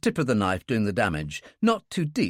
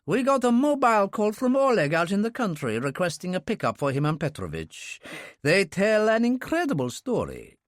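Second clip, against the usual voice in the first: lightly raised, earnest friend's voice; Russian man voice; 8 semitones higher than usual. A narrator's reading. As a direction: voice him as a sincere old comrade. Bounine reports to Alex.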